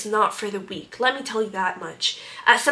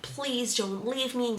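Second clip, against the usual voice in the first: mock crying plea; 8 dB quieter, slower than usual. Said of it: "he was crying and he was like".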